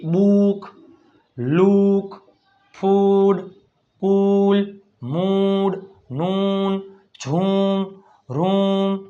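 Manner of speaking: oo stretched out in "બુક" and each word after; one-word drill, long oo in "રૂમ". Shown as a demonstration. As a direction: hold the vowel long in "મૂડ". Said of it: "મોટો ઉ".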